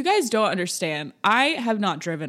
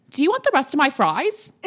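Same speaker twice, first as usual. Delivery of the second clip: friend offering; 9 semitones higher, 6 dB louder than usual. Retold or imitated. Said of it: "China was like".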